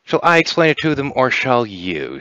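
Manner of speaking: teasing grin, starting high then slowing; grinning. Acted. Kiva asks who should explain the Eshalar, grinning. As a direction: offer it with a sly grin. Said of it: "with a little bit of a grin".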